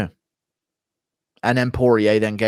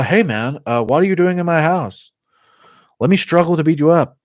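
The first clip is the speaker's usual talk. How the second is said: higher, hesitant put-on voice; Anthony Smith impression; 7 semitones higher, 4 dB louder than usual. Anthony Smith facing a home invader, higher mock challenge. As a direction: challenge the intruder in a raised voice. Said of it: "Anthony Smith and Presh".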